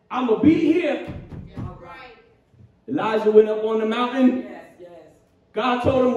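ordinary talk

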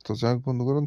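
ordinary talk, nothing marked

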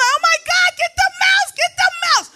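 high, loud scared squeal; hammed-up high panic; panicked shriek. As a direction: shriek for someone to get the mouse. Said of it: "I'm like".

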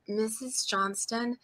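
soft, slow address to a parent; hushed, drawn-out name; gently breaking news. Retold or imitated. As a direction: imitate a therapist softly, carefully starting. Therapist gently breaking news, retold.

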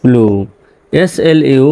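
normal talking voice

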